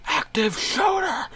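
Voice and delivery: a very high, slow panicked shout; police scream. Acted. Police officer storming in, high panicked shout. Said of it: "one of them screams".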